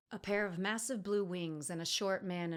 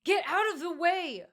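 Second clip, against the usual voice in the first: furious scream; 12 semitones higher, 9 dB louder than usual. A narrator's reading. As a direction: scream furiously at the guards blocking her. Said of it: "Emery furiously screamed at the men".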